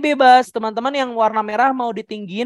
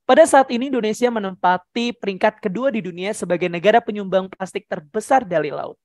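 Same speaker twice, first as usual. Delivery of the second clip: hurried, brisk statement; sped-up reading. Shown as a demonstration. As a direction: rattle it off quickly without feeling. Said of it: "Coba kalau dicepetin".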